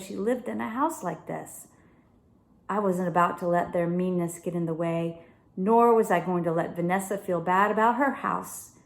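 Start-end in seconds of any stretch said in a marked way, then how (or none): none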